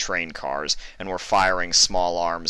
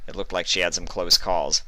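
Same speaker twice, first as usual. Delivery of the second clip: usual speaking voice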